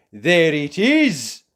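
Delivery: loud slow proud call; triumphant cry. Acted. Mister Forgetful pointing out the sheep, loud and triumphant. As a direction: point and announce it. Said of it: "cried Mister Forgetful".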